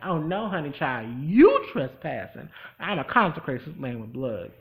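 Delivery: lightly voiced defiant witch; sassy retort. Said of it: "and then she's like".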